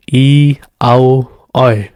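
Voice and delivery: ordinary talk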